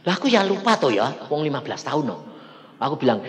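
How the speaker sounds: ordinary delivery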